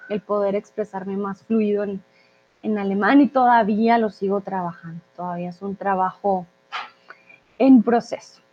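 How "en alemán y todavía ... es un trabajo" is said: ordinary talk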